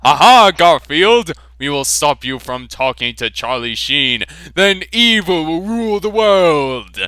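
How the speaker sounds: loud, high hammed-up evil gloat; villain gloat. Enemy jets taunt Garfield with hammy villainous glee. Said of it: "said the enemy jets".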